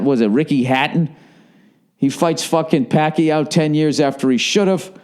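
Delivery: ordinary talk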